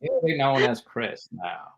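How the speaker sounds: slow, solemn famous-actor imitation; Morgan Freeman. Star names the bit player, slow and solemn. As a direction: pronounce it slowly like a naming. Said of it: "And then Morgan goes".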